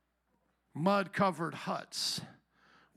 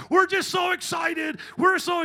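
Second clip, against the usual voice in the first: shouted over-the-top promo hype, much higher; mock church promo; 9 semitones higher, 7 dB louder than usual. Preacher parodying a church advertisement promo, hyped shout. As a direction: yell it like a cheesy church ad. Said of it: "Listen to any church advertisement promo".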